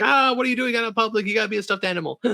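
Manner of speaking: high-pitched mock freak-out; mock alarm. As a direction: imitate a flustered scolding, starting loud and very high. Acting out everyone else's alarmed reaction.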